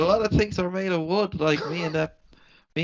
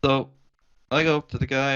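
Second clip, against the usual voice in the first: usual speaking voice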